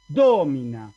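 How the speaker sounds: Domina con la DO fuerte y alargada; acento en la O. Shown as a demonstration. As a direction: carga el golpe de voz en la O de Domina. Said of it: "El acento cae en la O". (Domina, esdrújula, con la primera sílaba marcada)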